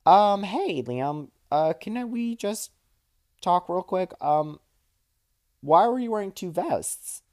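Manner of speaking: careful confrontation. Retold or imitated. Kate sitting Liam down backstage about the vests, careful and hesitant. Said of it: "sat him down and was like".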